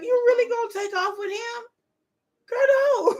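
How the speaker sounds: fast, high, half-shouted disbelief; begging her not to go, through laughter; high laughing plea. Retold or imitated. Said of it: "it's like".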